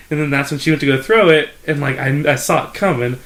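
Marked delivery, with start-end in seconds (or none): none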